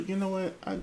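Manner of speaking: ordinary talk, nothing marked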